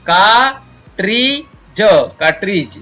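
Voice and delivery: "का, ट्री, ज" in separate pieces, then "कार्ट्रिज" joined; sounding out, silent R. Shown as a demonstration. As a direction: teach "कार्ट्रिज" with a silent R, falling at the end. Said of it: "यहाँ पर आर silent रहता है". ("का, ट्री, ज, कार्ट्रिज" तोड़कर, आर silent)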